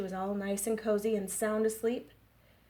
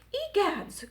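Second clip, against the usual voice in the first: much higher shocked exclamation; Mama Bear cry; 11 semitones higher, 4 dB louder than usual. Acted. Librarian reading to kids, Mama Bear higher and shocked. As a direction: gasp it out high. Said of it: "cried Mama Bear".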